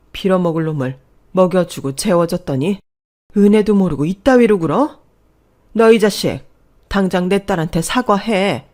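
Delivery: loud, raging demand, building to a shout; furious tirade. Acted. Mother grabs son-in-law by the collar, furious. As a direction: roar at him to defend your daughter.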